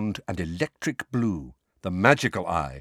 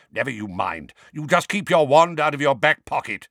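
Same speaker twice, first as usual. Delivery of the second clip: gruff growl rising louder; 5 semitones higher, 5 dB louder than usual. Acted. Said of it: "growled Mad-Eye".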